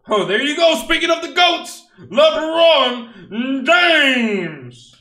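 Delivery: high, loud, drawn-out hype yell; excited shout. Real, not acted. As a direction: celebrate the big pull at full volume. Pulling a LeBron card.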